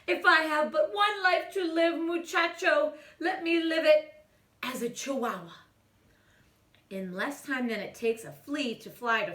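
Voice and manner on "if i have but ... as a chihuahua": put-on lightly Spanish-accented, high and loud. A narrator's reading. Kitten posing in the mirror in a Spanish accent. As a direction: play a dashing chihuahua in Spanish accent. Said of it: "using his best Spanish accent".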